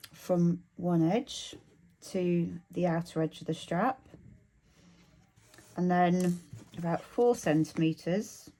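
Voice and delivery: talking around a pin in the mouth. Real, not acted. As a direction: mumble with a pin held between the lips. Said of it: "I'm talking funny 'cause I've got a pin in my mouth".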